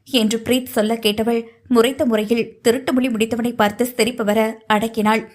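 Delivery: ordinary delivery, nothing marked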